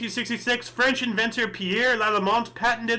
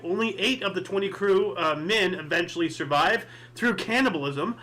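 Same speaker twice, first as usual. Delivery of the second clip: ordinary talk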